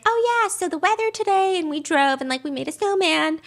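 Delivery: high, breezy small-talk voice; light, singsong mock-mom chatter; chatty mom. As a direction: imitate a mom chatting about nothing much. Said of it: "his mom being like".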